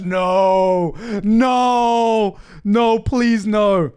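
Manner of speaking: drawn-out, high-pitched protesting no; long, pleading wail of refusal; dismayed pleading. Real, not acted. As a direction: beg against it in playful horror. Reacts in dismay to French suggestion.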